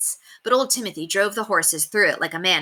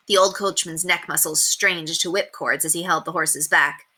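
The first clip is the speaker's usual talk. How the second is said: ordinary delivery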